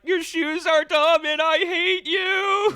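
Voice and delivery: high slow mock-crying whine; crying insult. Crying giant insulting her shoes, high mock-sobbing whine. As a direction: blubber the insult through tears. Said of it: "saying all this while crying".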